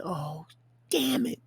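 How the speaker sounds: mock dismay. Retold dismay, pitch jumps.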